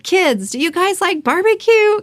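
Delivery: put-on high teasing call to the kids; mock-cheerful, to break the tension; playful joking. Husband joking to the kids before the event, high playful tease. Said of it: "Kinda kidding around".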